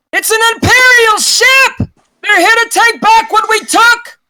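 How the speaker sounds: very high, loud alarm cry; crewmate shouting. Acted. Crewmate warning of an attacking Imperial ship, high urgent shout. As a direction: yell the warning across the whole ship. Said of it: "your crewmate shouting".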